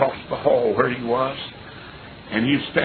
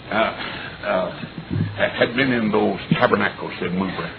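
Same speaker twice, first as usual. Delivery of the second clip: usual speaking voice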